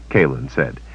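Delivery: ordinary delivery, nothing marked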